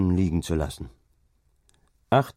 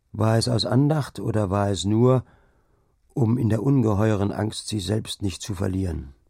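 plain reading voice

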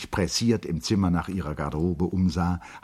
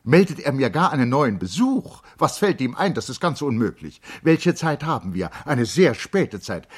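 high, loud, indignant; flustered noblewoman protesting; agitated lady; 7 semitones higher, 7 dB louder than usual. Acted. Charlotte refuses visit, agitated. (aufgebrachte Dame, höher und lauter)

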